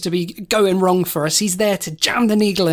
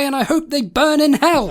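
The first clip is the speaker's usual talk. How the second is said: defiant rant; 7 semitones higher than usual. Acted. Birch admits wanting him dead.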